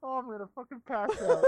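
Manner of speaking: high, breathless through laughter; barely getting words out from laughing; wheezing with laughter; laughing hard. Real, not acted. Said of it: "I almost fucking passed out there".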